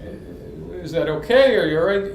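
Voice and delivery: mock-worried mom checking on her son; lightly voiced anxious mother; imitating mom. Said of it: "that's what she sounded like".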